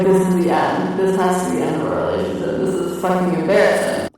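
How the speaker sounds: loud, overdramatic inner voice; mock panic. Girlfriend thinking during an awkward hug, loud mock panic. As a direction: cry out the embarrassment, hammed up.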